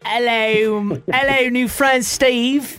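badly attempted British accent, closer to Australian; put-on British, slipping into Australian. Shown as a demonstration. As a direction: greet him in a hammed-up British accent. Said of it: "Give us your best British accent".

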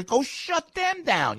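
high, drawn-out indignant demand; exasperated order, stretched out high; angry rant. Real, not acted. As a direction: demand it, pitch soaring. Ranting at authorities to act.

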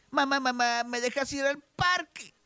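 put-on high-pitched kid asking mom; a child begging for permission, pitched well up; little kid's high, eager plea; pleading child. Joke's child asks mother permission.

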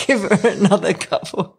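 amused, laugh breaking into the words; real laugh running into the line. Real, not acted. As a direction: chuckle as you say it. Amused by the retold instruction.

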